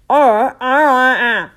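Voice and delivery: insistent stiff-mouthed "uh" noises; mud-muffled grunts. A narrator's reading. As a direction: try to speak with the mouth stuck. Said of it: "so plainly that it cracked the mud".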